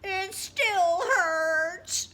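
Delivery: high, loud, slow whimpering cry; crying bird. Acted. Hurt bird still crying to his friend Raccoon. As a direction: wail it through tears like a small child. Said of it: "Bird kept crying".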